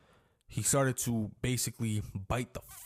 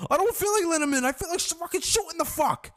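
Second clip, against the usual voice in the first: much higher, louder mock rant; dad fed up; 6 dB louder than usual. The bitten dad about his dog, a high loud mock rant. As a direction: imitate the dad's furious outburst. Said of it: "my dad just saying".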